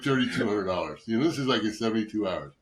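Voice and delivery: ordinary talk, nothing marked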